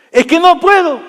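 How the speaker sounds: high-pitched put-on excuse; mock protesting congregant. Preacher mimics congregant who won't forgive, mock protest. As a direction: plead helplessly, hammed up.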